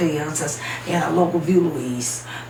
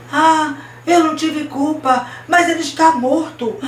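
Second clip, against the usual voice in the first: high, loud acted wail; tearful confession; 9 semitones higher, 5 dB louder than usual. A narrator's reading. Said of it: "ele chorava tanto". (confissão chorosa encenada, aguda e alta)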